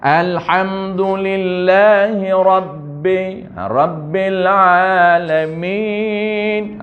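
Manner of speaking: Alhamdulillahi Rabbil Alamin slow, Hindustani style, loose vowels; Hindustani-style recitation. Shown as a demonstration. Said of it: "tu banyak me, be, le".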